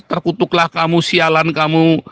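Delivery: ordinary delivery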